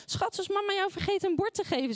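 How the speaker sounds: high, sing-song mock sweetness; sarcastic teasing. Mother jokes to child about the forgotten plate, sarcastically sweet. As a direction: tease the child with syrupy sarcasm. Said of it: "heel sarcastisch".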